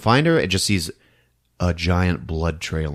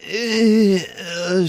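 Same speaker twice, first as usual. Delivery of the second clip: high, strained grunt of effort; struggling groan; 12 semitones higher than usual. Retold or imitated. A beaten girl in the hall, struggling groan. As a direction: groan like you can barely get up.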